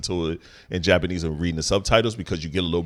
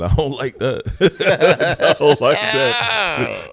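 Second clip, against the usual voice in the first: high laughing protest; 10 semitones higher, 3 dB louder, slower than usual. Real, not acted. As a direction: laugh through it, high-pitched. Laughing at cohost's voice.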